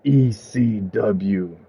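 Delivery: very slow, low closing pronouncement; grave verdict. A narrator's reading. Storyteller declares Thanatos has killed the company, grave and slow. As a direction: spell it out slowly and low.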